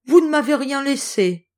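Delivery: high, loud wailing complaint; lamenting. A narrator's reading. Antelope wails that nothing was left, lamenting. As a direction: wail at the empty plates. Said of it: "se mit aussitôt à se lamenter".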